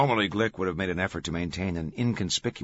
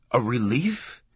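higher, rising startled question; sudden exclamation; 5 semitones higher, 4 dB louder, slower than usual. A narrator's reading. Langdon seizes on the guard's clue, exclaiming. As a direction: exclaim it as it strikes you. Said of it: "he exclaimed suddenly".

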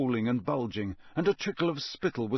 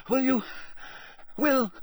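addled official growing frantic at a tree; frantic repeat; 12 semitones higher than usual. A narrator's reading. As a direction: demand it over and over. Crouch repeating, more frantic.

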